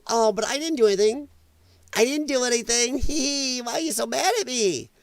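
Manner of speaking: high, whiny mock protest with a giggle; mock innocence. Host imitates smirking critics of Charlie, mock innocent. Mimics people feigning innocence.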